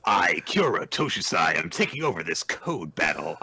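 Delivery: lightly voiced Kaiba, a touch of swagger; a hint of a haughty rival taking charge; Kaiba impression. Toshusai declaring a takeover as Kaiba, lightly voiced. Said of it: "being Kaiba".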